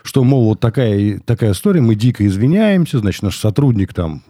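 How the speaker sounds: ordinary talk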